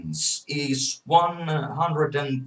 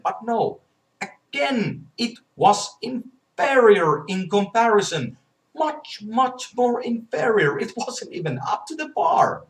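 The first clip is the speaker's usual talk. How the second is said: worked-up rant; 9 semitones higher, 3 dB louder than usual. Real, not acted. Heated rant about console inferiority.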